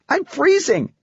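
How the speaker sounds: put-on very high girlish wail of protest; complaining daughter. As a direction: call back to dad in a high, complaining voice. Daughter calls back while hurrying off.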